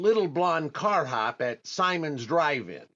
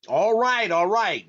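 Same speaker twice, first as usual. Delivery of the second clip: all right, all right, higher and louder in a panicked rush; flustered loud give-in; 5 semitones higher, 6 dB louder than usual. Acted. To the clerk who threatened a punch, a flustered loud surrender. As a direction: cave in fast, rattled by the clerk's threat.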